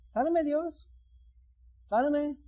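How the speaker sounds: high, whiny mock plea for healing; mock pleading. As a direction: imitate someone begging lazily to be healed. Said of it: "así que la gente cree". (la gente le pide a Dios sanidad con súplica burlona y aguda)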